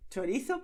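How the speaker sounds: Chorizo said loud and bright, with th; Chorizo pronounced chorizo with a th; emphatic chorizo. Shown as a demonstration. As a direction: punch out Chorizo for the class. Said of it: "en español en chorizo".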